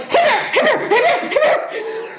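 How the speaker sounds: hollering to scare bears away; nervous hiker shouting hey bear over and over; frantic bear yell. Retold or imitated. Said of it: "you're supposed to yell".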